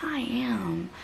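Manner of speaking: slow, whispered giving in; defeated whisper. Retold or imitated. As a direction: breathe it out as if agreeing with the insult. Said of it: "I'd be like".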